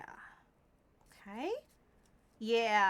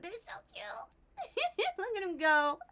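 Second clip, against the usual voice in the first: cooing; 8 semitones higher, slower than usual. Real, not acted. Cooing over cute cats.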